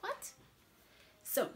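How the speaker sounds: ordinary talk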